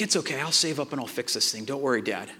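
soft, low imitation of a brave son; gentle reassurance. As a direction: quietly let dad off the hook. Son's gentle reply through tears.

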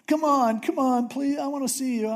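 put-on high, wheedling kid begging; like a little kid. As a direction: whine like a child who wants something. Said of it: "Like a little kid".

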